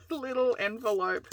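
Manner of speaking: ordinary talk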